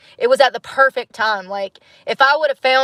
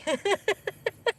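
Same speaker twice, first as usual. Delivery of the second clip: high giggle; 11 semitones higher, 9 dB quieter than usual. Real, not acted. Laughs at own remark.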